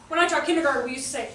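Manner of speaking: ordinary talk, nothing marked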